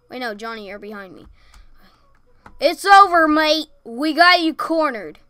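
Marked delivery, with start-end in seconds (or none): 2.6-5.2 tough cop voice. Pretend. Taunting cornered target.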